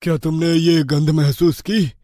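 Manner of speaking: ordinary delivery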